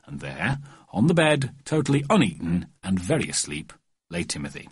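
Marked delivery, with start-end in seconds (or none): none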